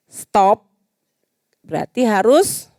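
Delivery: ordinary delivery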